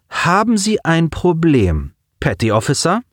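chill him with calm menace; icy and calm. A narrator's reading. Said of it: "Ihre Stimme war eisig und ruhig".

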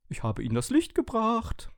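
hohe, verstellte Dosenstimme; Stimme aus dem Mülleimer. A narrator's reading. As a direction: als Erbsendose hoch verkünden. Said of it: "klang es dumpf aus dem Mülleimer". (verkündende Konservendose in hoher Stimme)

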